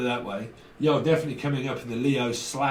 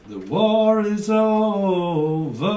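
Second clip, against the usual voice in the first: dramatic proclamation; 5 semitones higher, 6 dB louder, much slower than usual. Pretend.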